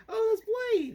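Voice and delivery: mock audience squeal, very high-pitched; imitated high-pitched excited gasp of recognition; excited onlooker. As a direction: squeal it like a thrilled fan spotting someone. Quoting excited audience reaction.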